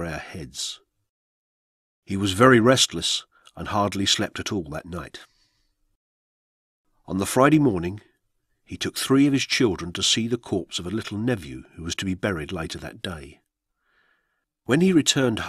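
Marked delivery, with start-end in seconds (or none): none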